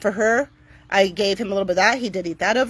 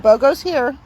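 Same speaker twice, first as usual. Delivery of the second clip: higher, louder, cheery; upbeat announcement; 5 semitones higher, 6 dB louder than usual. Real, not acted. Telling Timber that Bogo is here, cheerful and high. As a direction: announce it like good news.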